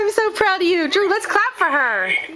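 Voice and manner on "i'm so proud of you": ordinary delivery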